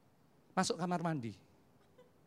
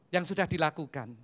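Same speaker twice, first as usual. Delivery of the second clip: ordinary talk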